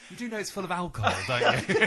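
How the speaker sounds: fast, loud, laughing through it; laughing, teasing. Real, not acted. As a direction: tease while laughing hard.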